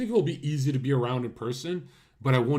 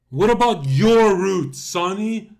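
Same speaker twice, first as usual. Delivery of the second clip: loud, slow, higher mock demand; hammed-up jeering repeat; mocking challenge; 6 semitones higher, 10 dB louder, slower than usual. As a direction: throw his own question back at him, loud and slow. Mocking the video's question.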